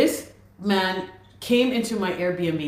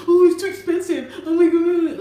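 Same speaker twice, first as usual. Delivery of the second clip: mocking whine, pitched much higher and louder; whiny complaining; 11 semitones higher, 7 dB louder than usual. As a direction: mimic him whining about the cost. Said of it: "He was always complaining".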